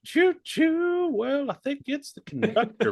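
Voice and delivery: a chirpy toy train starting to answer; Thomas the Tank Engine voice. Pretend. Thomas the Tank Engine on a talk show, in a high cartoon voice. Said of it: "Thomas is gonna come up. He's gonna be like".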